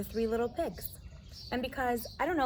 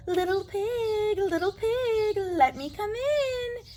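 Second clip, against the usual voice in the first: very high, slow, louder fake piggy voice; disguised coyote; 11 semitones higher, 4 dB louder, slower than usual. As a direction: coyote pretending to be a javelina. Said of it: "make his voice sound just like another javelina's".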